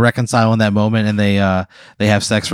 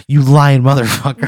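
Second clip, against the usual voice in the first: louder, snarled-out accusation; angry accusation; 3 semitones higher, 6 dB louder than usual. Acted. Wife accusing comedian as she attacks, angry accusation. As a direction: hit every word hard, voice raised.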